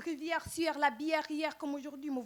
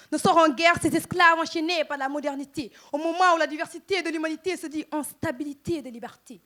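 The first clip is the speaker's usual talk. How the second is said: raised, louder declamation, proclaiming defiance; loud and forceful; 3 semitones higher, 8 dB louder than usual. Acted. Slam poet speaking for the enslaved, loud and defiant. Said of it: "je le dis haut et fort".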